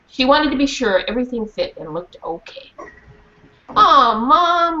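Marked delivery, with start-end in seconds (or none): 3.7-4.8 whiny protest. Acted. Rennie whining at mom.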